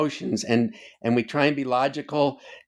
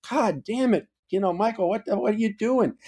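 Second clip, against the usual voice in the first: higher, exasperated scolding of himself; mock self-scolding; 8 semitones higher than usual. Scolding himself after acting rashly, exasperated and higher. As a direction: throw up your hands at yourself.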